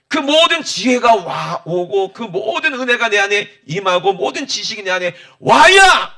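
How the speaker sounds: loud, high, fervent sermon delivery; impassioned preaching. Acted. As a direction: preach it out to the whole congregation. Preaching at full force. (힘차게 외치는 설교)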